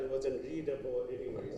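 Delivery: ordinary talk, nothing marked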